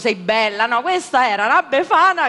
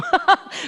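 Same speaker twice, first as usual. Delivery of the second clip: amused laugh; 10 semitones higher than usual. Real, not acted. Host laughs at her own joke.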